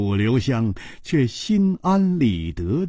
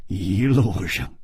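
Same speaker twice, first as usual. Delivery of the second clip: usual speaking voice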